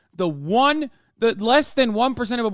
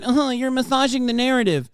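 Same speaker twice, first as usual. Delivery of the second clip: a touch higher, smug nasal-free sneer; mocking chatter; 5 semitones higher than usual. Streamer mocking the chatter's smug line. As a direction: parrot the chatter smugly. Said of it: "You just said".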